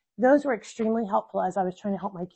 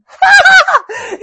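loud high laugh; 15 dB louder than usual. Real, not acted. Laughing at own exasperation.

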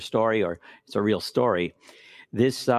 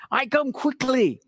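mock hard; 11 semitones higher than usual. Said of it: "he hit it again really hard".

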